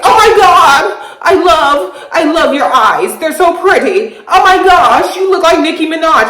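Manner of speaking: high-pitched mock gushing; tipsy gushing. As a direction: imitate a tipsy sister fawning over someone. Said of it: "sister being drunkenly saying".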